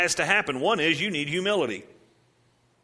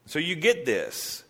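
normal talking voice

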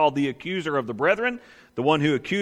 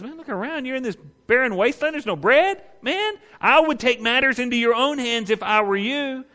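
higher, coaxing devil voice; tempting Satan; 6 semitones higher than usual. Acted. Satan urging hungry Jesus to take matters, coaxing. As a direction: talk him into it like a smooth friend.

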